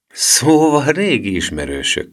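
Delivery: high, drawn-out exclamation of discovery; exclaimed. A narrator's reading. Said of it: "kiáltotta a felügyelő". (elnyújtott felkiáltás)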